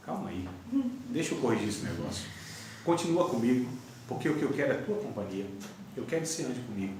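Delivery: low, soft fatherly voice; calmly steady a child, keeping it low; quiet, tender correction; patient reassurance. Acted. God reassuring his child.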